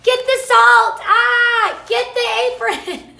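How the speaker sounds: high, loud excited calling out; excited squeal. Retold or imitated. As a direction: shriek it like you just fell in love with it. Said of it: "we can't stop screaming about it".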